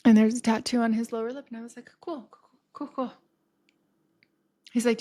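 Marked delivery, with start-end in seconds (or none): 2.0-3.1 soft, higher-pitched nervous acknowledgment; small hushed voice trying to seem unfazed; feigned composure. Retold or imitated. Woman hiding panic at his lip tattoo, hushed.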